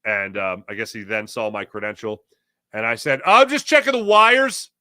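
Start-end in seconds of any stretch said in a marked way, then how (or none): 3.2-4.7 loud, high, drawn-out alibi; called-out excuse. Retold or imitated. Caught worker answering the cop, hammed-up called-out excuse. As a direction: shout an innocent excuse across the space.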